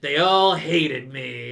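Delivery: bitter grudge. Retold or imitated. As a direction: drag it out, holding a grudge. Goobs voiced bitter, slow.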